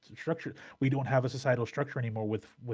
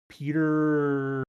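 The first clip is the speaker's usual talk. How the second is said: ordinary talk, nothing marked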